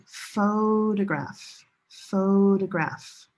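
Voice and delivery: slow "photograph" with a long "PHO"; first-syllable stress. Shown as a demonstration. Teacher contrasting related words, long stressed "PHO" in "photograph". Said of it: "Different stress location".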